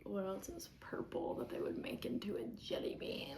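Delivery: whispered aside; hushed, breathy question. Real, not acted. Whispered aside during competition.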